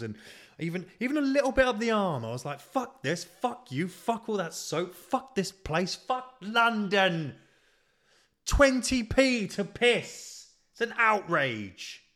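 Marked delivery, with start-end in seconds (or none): 0.6-2.3 getting carried away with the scrubbing; building excitement; worked-up. Real, not acted. Ranting about scrubbing with soap. 2.6-12.0 high, loud, slow furious tirade; mock fury at London; outraged rant. Ranting inner quote at London.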